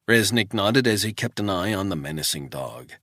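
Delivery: ordinary delivery, nothing marked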